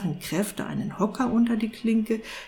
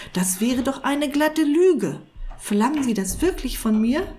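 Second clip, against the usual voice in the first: astonished; 7 semitones higher than usual. A narrator's reading. Cat asked to tell a lie, astonished. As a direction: protest in disbelief. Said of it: "sagte der Kater verwundert".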